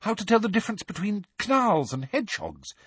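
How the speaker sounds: high, sincere, slowing on the end; earnest plea. A narrator's reading. Hermione urging Hagrid to teach safe things, earnest and high. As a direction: plead sincerely with a friend. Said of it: "said Hermione earnestly".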